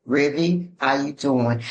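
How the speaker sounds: a low, smooth voice with bass in it; deep bass voice. Retold or imitated. Husband calling his friend in a low, bassy voice. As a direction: speak low and smooth to win her over. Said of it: "He said it with some bass in his voice".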